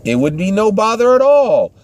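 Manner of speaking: high hostess eagerly offering coffee; church lady voice. Retold or imitated. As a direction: insist sweetly, no trouble at all. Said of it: "And the other lady says".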